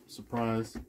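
ordinary talk, nothing marked

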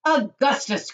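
high, loud exclamation; crying out. A narrator's reading. Mister Wonka stopping Augustus, crying out. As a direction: call the name in protest. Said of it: "cried Mister Wonka".

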